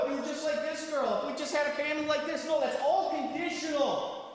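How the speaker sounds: high, insistent sermon voice; fervent, high-pitched exhortation; impassioned preaching. Acted. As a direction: preach it high, knocking down each condition. Preaches at full force.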